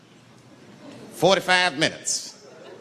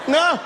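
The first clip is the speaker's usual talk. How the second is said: horrified scream; 12 semitones higher than usual. Acted. Unlucky man behind getting hit, horrified high scream.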